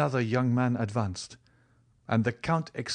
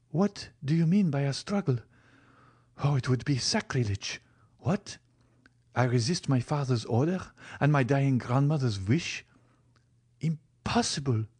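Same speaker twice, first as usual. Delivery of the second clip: higher-voiced young woman in dismay; Valentine, appalled; 5 semitones higher than usual. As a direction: recoil in disbelief, voice lifted. Valentine appalled at idea of resisting.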